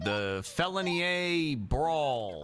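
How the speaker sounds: live ringside commentary, running call of every punch; fight play-by-play. Acted. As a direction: call the fight blow by blow like a sportscaster. Live play-by-play of round two.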